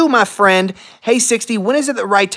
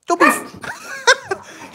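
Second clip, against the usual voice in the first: laughing; much slower than usual. Real, not acted. Breaks into laughter scolding dog.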